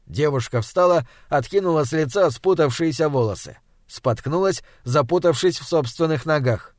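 ordinary delivery, nothing marked

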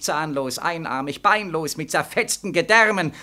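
normal talking voice

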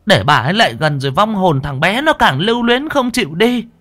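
put-on high, loud old woman snapping orders; angry old granny voice; scolding. A narrator's reading. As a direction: shout them into line. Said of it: "Bà cụ Đoành quát".

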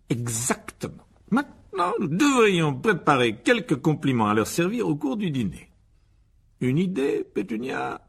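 high, loud, ceremonious instructions; bossy pompous uncle. Acted. Uncle asking for compliments to serve at dinner, pompously bossy. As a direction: run the rehearsal like a self-important host.

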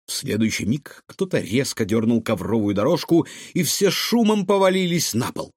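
ordinary delivery, nothing marked

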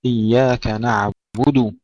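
slow recitation, نعبد ending in rounded "du"; full damma. Shown as a demonstration. Teacher modelling the verse, slow with full damma on نعبد. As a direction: give the final u of نعبد its full value. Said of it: "يحقق الضمة قبل أن ننتقل إلى الواو".

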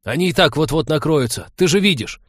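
high, loud frantic shout; heated shout. A narrator's reading. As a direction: shout it in the heat of the moment. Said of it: "Збышек в запале".

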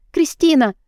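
громко зовёт по имени; кричи на весь дом, ища девочку. A narrator's reading. (героиня ищет Кристину, зовёт в голос)

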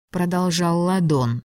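ordinary delivery, nothing marked

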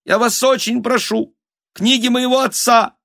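very high, thin desperate begging; pleading squeak. A narrator's reading. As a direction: squeak your plea over the din. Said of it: "прорывался писк Анны Васильевны". (умоляющий писк)

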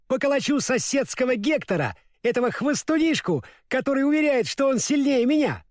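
high-pitched, cocky dog voice; boastful little dog. A narrator's reading. Dog vowing to thrash the neighbour's Hector, boastful. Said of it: "подхватил Татошка".

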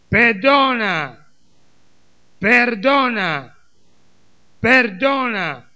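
"perdona" drawn out slowly and loudly; emphatic command. Retold or imitated. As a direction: press each "perdona" as a slow, loud divine command. Preacher insists on God's command.

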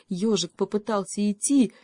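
ordinary delivery

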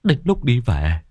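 high-pitched tearful wail; choked sobbing. Acted. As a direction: wail out a desperate plea through acted sobs. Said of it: "khóc nghẹn ngào". (giọng khóc nghẹn ngào)

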